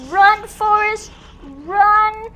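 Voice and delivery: high, loud call to run; urgent mock call. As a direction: shout it like cheering on a runner. Urging escape with film quote.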